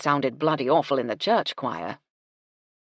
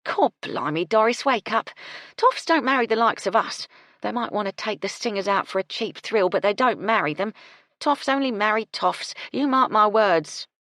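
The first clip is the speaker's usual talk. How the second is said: louder, firm warning; blunt cook; 5 semitones higher, 5 dB louder than usual. Acted. Gertie warns Doris that toffs only marry toffs, loud and firm. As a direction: set her straight.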